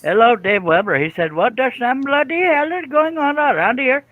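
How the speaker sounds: high, loud mock Indian accent; call-center Indian accent. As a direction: pitch it way up like a hammed-up Indian call-center agent. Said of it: "I thought it was a call center accent".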